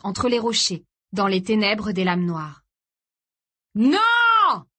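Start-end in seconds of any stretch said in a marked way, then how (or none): 3.8-4.7 very high, loud, drawn-out scream; desperate full-voiced yell; cry. A narrator's reading. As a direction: scream it out in horror. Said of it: "cria Tony".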